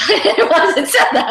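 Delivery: a real burst of laughter as she reads; giggling at her own line; laughing. Real, not acted.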